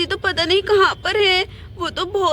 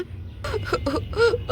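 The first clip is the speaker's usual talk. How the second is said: crying wail; 4 semitones higher, 6 dB quieter than usual. Acted. Bride crying at farewell.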